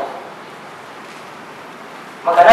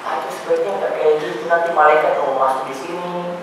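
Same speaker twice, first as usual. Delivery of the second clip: softly coaxing, much softer; gentle; 6 semitones lower, 8 dB quieter than usual. Retold or imitated. Preacher gently advising an elderly mother, soft and tender. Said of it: "penyampaiannya mesti halus gitu".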